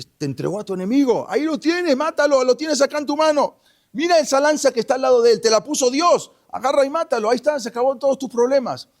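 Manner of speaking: high-pitched, loud and insistent pleading; urgent egging-on. Retold or imitated. Abishai eggs David on to spear the sleeping king, urgent.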